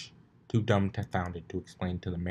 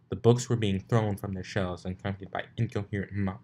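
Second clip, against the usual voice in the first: usual speaking voice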